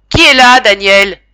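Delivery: crié bien plus fort, voix tremblante; cri inquiet. A narrator's reading. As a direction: appelle de loin, plein d'angoisse. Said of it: "me cria monsieur Essette. Sa voix tremblait". (cri tremblant bien plus fort)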